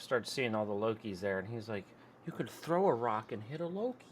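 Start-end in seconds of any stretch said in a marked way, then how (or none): 2.3-4.1 lightly voiced impression, higher and softer; a hint of the character, quietly lifted in pitch; Mobius. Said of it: "That's a good impression".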